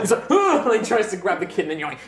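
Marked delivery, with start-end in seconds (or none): 0.3-0.7 high, loud comic oof; grunt on impact. Acted. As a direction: grunt as if hit by a body. Guard hit by thrown kid.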